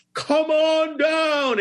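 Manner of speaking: high, long-drawn game-show holler; mocking announcer call. Producer mocking the new warm-up guy's game-show credit, jeering. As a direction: stretch it out high, making fun of him. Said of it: "making fun of me and mocking me".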